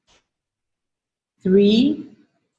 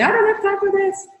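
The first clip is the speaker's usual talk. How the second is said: much higher, hurried mock protest; impatient inner voice; 12 semitones higher than usual. Coach voicing the viewer's busy mind, high mock protest. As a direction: play the busy mind brushing it off. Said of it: "your mind will say".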